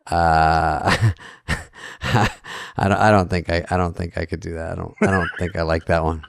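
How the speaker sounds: quiet, low-key nervous refusal; nervous Smigel voice. Retold or imitated. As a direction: softly dodge with a nervous chuckle. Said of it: "he has a nervous laugh".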